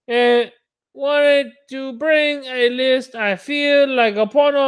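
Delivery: high-pitched, put-on North Korean accent; cartoon impression gone wrong into a North Korean accent; botched North Korean accent. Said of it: "all I'm getting is North Korean".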